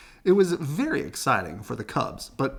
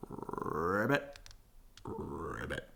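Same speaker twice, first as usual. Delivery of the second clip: frog croaks; 6 semitones lower, 9 dB quieter, much slower than usual. Acted. Frog noises.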